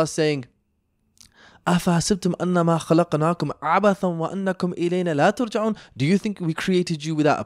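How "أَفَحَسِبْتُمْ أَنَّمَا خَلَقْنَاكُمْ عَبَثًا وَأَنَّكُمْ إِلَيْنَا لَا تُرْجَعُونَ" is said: Quran recitation. Real, not acted. Quotes a Quran verse.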